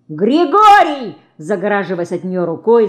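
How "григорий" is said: high, loud, drawn-out yell; yelling for help. A narrator's reading. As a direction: yell the name long and high. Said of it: "закричал мальчишка".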